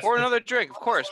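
eager call for another drink; excited cheer. Real, not acted. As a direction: pitch way up, loud.

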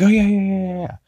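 high, eager yeah-yeah-yeah; mock eager falsetto yes. Eager character quote in recap.

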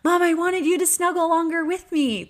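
imitating a little boy, high pitched; young son. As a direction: plead like a small child wanting a cuddle. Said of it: "he'll come downstairs and say".

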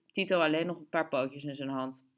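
usual speaking voice

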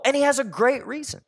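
usual speaking voice

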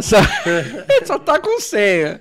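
high, slow, laughing mock quote; mocking scammer, laughing. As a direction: sneer it out through laughter. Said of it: "Os cara fala assim".

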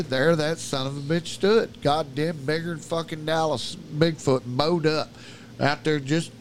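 ordinary delivery, nothing marked

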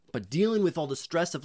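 ordinary talk

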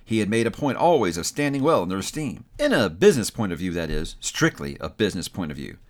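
plain reading voice

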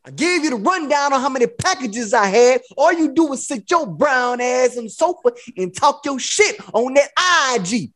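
high, slick pimp tirade, words stretched; going in like a fed-up pimp; Iceberg Slim. Retold or imitated. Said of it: "I was Iceberg Slim in that shit".

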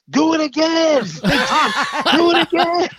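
high, loud laughing cheer; cheering kids. Retold or imitated. Kids cheering after the jump, loud and laughing. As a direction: egg him on with a laughing shout. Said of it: "they're like".

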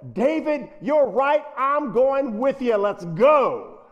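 hammed-up eager king; mock rousing Saul. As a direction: fire up as the brave king he should have been. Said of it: "That's what it should have said".

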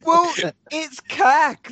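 loud, high, slow laugh through words; laughing scoff. Real, not acted. Mark mocking the scarless actor, laughing. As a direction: scoff while cracking up. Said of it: "laughing at the scar complaint".

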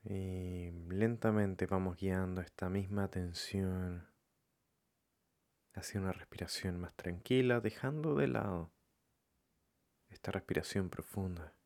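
calm, softened meditation voice with long pauses; guided meditation. Acted. Leading listeners to follow their breathing, calm meditation voice. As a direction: guide them gently, leaving room between phrases.

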